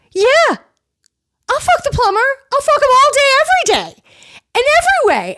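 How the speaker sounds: camp it up like a defiant diva; defiant high mock voice. Margaret hurling a defiant comeback at the table, in high sassy falsetto.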